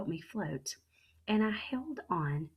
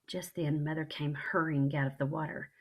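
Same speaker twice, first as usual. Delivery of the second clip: plain reading voice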